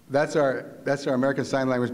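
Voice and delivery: ordinary delivery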